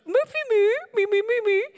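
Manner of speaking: put-on high, sing-song baby talk; baby talk to a dog. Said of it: "you do little".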